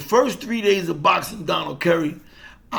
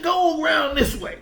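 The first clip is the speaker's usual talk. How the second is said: manager's instructions, pitched much higher and pushing louder; manager coaching; 10 semitones higher, 3 dB louder than usual. Retold or imitated. Manager telling the fighter how to circle, high and pressing. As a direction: drill the advice like a corner man. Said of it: "He said".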